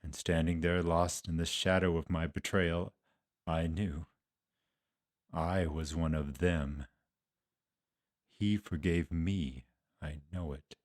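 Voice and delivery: low, soft, halting remorse; guilt-ridden confession. Acted. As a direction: admit it quietly, weighed down by guilt. Guilt-ridden disciple cannot accept forgiveness.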